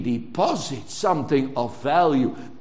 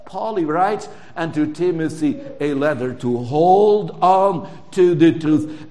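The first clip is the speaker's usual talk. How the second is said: ordinary delivery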